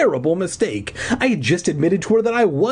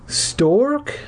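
higher questioning call; cried out; 13 semitones higher than usual. A narrator's reading. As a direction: cry out the question in a raised pitch. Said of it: "she cried".

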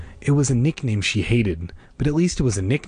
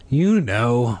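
plain reading voice

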